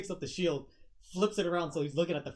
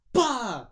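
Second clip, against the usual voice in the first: loud, high punch impact noise; punch sound; 7 semitones higher, 12 dB louder than usual. Acted. Game master sounds out a punch to the face, bursting out loud. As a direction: burst out a sudden loud "bah".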